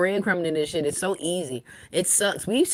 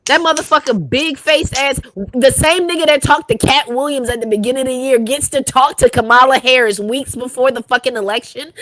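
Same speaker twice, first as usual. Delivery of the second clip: loud, higher heated ranting; shouting in disbelief at the choice; angry rant; 3 semitones higher, 6 dB louder than usual. Real, not acted. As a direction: go off, loud and fed up. Ranting angrily about Shannon Sharpe.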